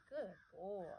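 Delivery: ordinary talk, nothing marked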